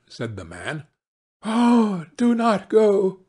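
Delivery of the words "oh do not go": high, pleading cry. A narrator's reading. Wife begs husband not to go, crying out. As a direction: beg with the pitch held up. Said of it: "cried the wife".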